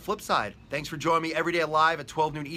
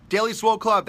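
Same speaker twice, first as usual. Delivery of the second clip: high, loud hype call of the club name; hype announcement; 7 semitones higher, 8 dB louder than usual. Acted. Host hyping his live viewers, loud and high. Announcing the show's daily winner segment.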